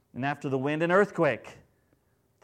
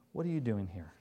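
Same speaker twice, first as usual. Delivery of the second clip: gentle; 3 semitones lower than usual. Pretend. God gently asking Elijah, tender. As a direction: ask it with gentleness. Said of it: "There's a gentleness to God".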